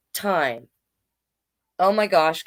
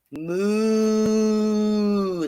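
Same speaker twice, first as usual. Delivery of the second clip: a long, softer moo; reader's quieter retake of the moo; gentler cow moo; 3 dB quieter than usual. A narrator's reading. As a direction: let the cow sigh it out calmly. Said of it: "That's a little better".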